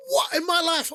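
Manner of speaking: high disbelieving what; stunned by the mind games; mock bafflement. Baffled reaction retold.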